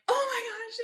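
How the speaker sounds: very high-pitched retold gush; gushing excitement. Telling boyfriend all this stuff, high overwhelmed gush. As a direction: squeal it, imitating an overwhelmed caller.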